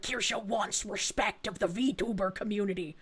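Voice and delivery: mocking the liars. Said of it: "the people who lie about me are like".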